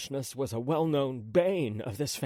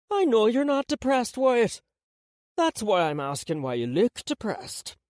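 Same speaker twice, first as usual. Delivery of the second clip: grandmother voice; 9 semitones higher, 5 dB louder than usual. A narrator's reading.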